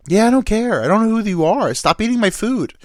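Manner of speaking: high, loud, indignant shout; angry yell. Retold or imitated. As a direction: snap at a stranger. Buddy yells at woman.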